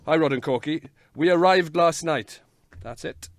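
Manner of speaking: usual speaking voice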